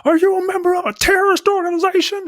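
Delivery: high-pitched, fast grilling question; mock interrogation.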